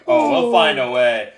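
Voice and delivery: laughing. Real, not acted. Still laughing at whale joke.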